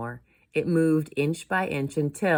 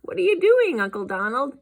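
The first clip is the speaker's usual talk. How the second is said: put-on high, loud child's question; high-pitched kid calling to an uncle; curious nephew; 11 semitones higher, 7 dB louder than usual. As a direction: pipe up brightly as a young nephew.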